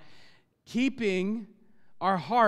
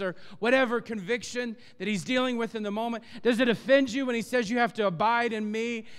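fervent preaching, pressing the congregation; 4 semitones higher, 3 dB louder than usual. Acted. Pastor challenging the congregation, fervent preaching. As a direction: preach it hard, pushing each question. Preaching at full force opening.

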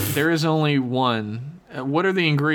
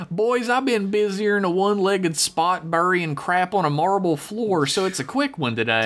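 folksy hillbilly letter-writer; 5 semitones higher than usual. Acted. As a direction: read it as a drawling backwoods yokel. Reads listener email in character.

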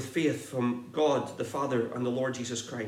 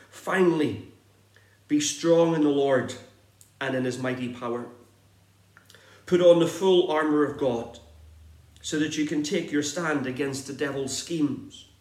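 scripture reading; 4 semitones higher than usual. Acted. Preacher reading Ephesians six aloud to the church, declaiming. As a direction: proclaim the passage to the congregation.

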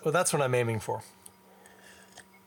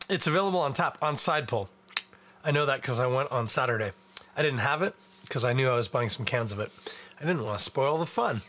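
ordinary talk, nothing marked

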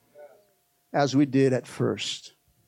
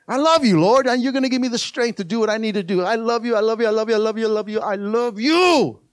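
fervent mock prayer; 7 semitones higher, 6 dB louder than usual. Preacher models a morning prayer to the Lord, fervent. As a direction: pour out love in a building rush.

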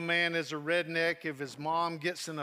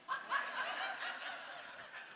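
high breathless laugh; 7 dB quieter than usual. Retold or imitated. Laughing at his own joke.

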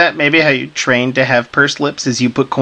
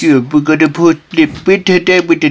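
pursed lips; 3 semitones higher, 3 dB louder than usual. Pretend. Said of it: "through pursed lips".

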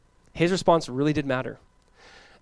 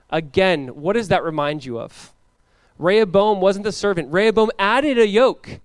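ordinary talk, nothing marked

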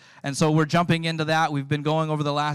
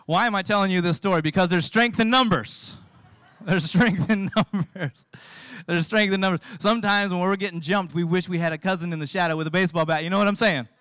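louder, higher preaching voice; 4 semitones higher, 4 dB louder than usual. Acted. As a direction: drive the point home to the congregation. Preacher drives home the point.

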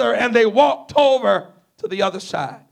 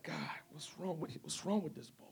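whisper; 5 semitones lower, 24 dB quieter than usual. Acted. It's like, whisper.

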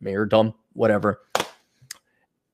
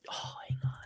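hushed unvoiced aside; whisper; 19 dB quieter than usual. Real, not acted. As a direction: drop to a whisper.

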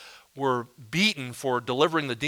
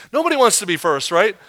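ordinary talk

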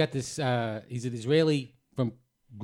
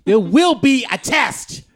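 high, loud, slow proclamation; mock teacher's warning; 10 semitones higher, 12 dB louder, much slower than usual. Storyteller as teacher warning his listeners, mock-stern shout. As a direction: boom it out word by word.

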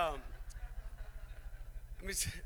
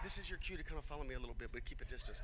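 hushed aside; 9 dB quieter, faster than usual. Real, not acted. Pastor quietly cueing his helper, hushed aside. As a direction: whisper the cue off-mic.

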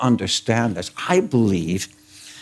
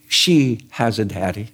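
ordinary delivery

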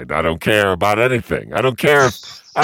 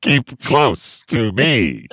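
very slow, higher, creepy plea; 5 semitones higher, much slower than usual. Acted. Suggesting a nice note to sign on a purse, turning creepy. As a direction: stretch it out until it gets weird. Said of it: "it got kinda really creepy".

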